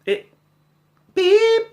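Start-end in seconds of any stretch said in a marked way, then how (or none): 1.1-1.7 high-pitched beep imitation; mock beep. Mimicking the machine's alert, a sharp beep.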